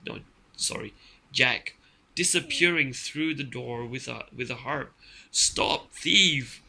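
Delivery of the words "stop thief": loud, punched storybook shout; giant's roar. A narrator's reading. Giant shouting after Jack as he flees, roaring. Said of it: "the giant roared".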